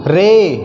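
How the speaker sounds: रे with a long held vowel; lengthened vowel. Shown as a demonstration. Teacher models ay words for students, lengthened vowel. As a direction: draw out the vowel in रे. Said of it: "थोड़ा सा बड़ा होगा".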